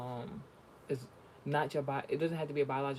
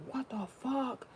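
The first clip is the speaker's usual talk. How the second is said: driver's shock; 10 semitones higher, slower than usual. Retold or imitated. Imitating the driver again.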